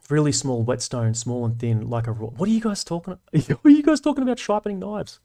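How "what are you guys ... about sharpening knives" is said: much higher, laughing disbelief; high, laughing what-is-going-on; incredulous laugh. Real, not acted. Surprised by the chat topic.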